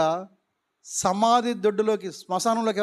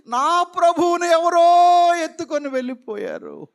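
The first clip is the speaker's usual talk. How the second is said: higher, louder anguished cry; loud lament; 7 semitones higher, 6 dB louder than usual. Acted. Mary crying out that her Lord was taken, anguished. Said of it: "చూసారా ఎంత ప్రేమ?".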